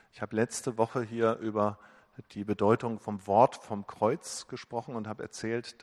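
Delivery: ordinary talk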